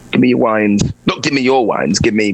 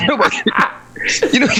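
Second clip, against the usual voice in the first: laughing; 12 semitones higher, faster than usual. Real, not acted. Laughing reply.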